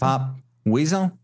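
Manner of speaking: put-on old Hollywood transatlantic accent; glamorous old Hollywood star voice. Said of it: "in that transatlantic accent".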